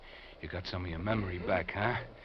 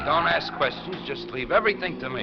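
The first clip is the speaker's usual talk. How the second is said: higher, louder, cutting him off; angry shout; 11 semitones higher, 11 dB louder than usual. Acted. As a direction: shout down his questions. Kidnapper silencing his captive.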